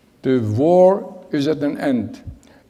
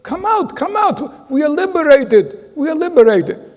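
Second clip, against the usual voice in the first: much higher, louder joyful shout; excited yelling; 13 semitones higher, 6 dB louder than usual. Retold or imitated. Friends shouting to David that they are liberated. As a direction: call him out of the outhouse with joy. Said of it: "my two friends yelling at me".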